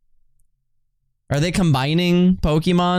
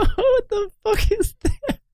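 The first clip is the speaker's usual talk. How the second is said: very high, cracking shocked cry; shrieking disbelief. Real, not acted. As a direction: squeal it out in disbelief. Seeing a weird fusion.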